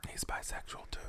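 unvoiced gossipy whisper. Real, not acted. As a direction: whisper it like a secret.

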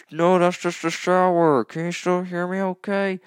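shouting from the shower over the water; from another room. Shown as a demonstration. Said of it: "let me demonstrate what it would sound like".

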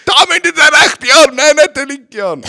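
high, loud yelled instructions; shouting. Pretend. Coach explains technique in kids' training, shouted. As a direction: yell the technique at the class. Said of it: "voll am Schreien die ganze Zeit".

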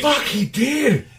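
high, drawn-out exclamation of disbelief; real astonishment. Real, not acted. Friend realizes he missed the clue, astonished. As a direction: blurt it out slowly, pitch leaping up.

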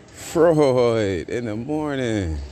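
drawn out. Shown as a demonstration. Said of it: "repeats the phrase".

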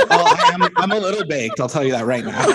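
laughing through the words; giggling while admitting something. Real, not acted. Laughing while admitting being high.

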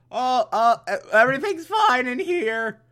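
high put-on cover-up voice; hammed-up, stammering fake reassurance; Alfred. Alfred answers Batman through the door, high mock-innocent voice.

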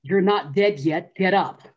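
slow, loud, otherworldly command; a voice ordering her to rise, slowly and loud; booming order from beyond; commanding voice heard. Retold or imitated. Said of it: "I heard".

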